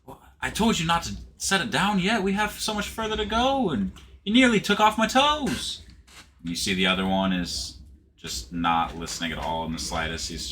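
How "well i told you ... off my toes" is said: high, scolding elf voice; much higher, complaining character voice; annoyed sea elf. Acted. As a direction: nag your partner like a fussy elf. Sea elf argues with partner.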